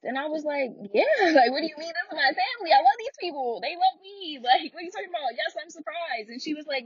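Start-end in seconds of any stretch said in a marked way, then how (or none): 0.9-6.3 high-pitched, disbelieving insistence; incredulous protest. Retold or imitated. Retelling her answer to the therapist, incredulous and high-pitched.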